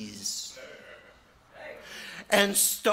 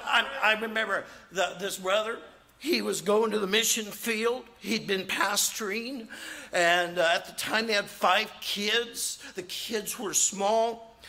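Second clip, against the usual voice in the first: ordinary delivery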